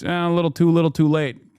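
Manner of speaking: usual speaking voice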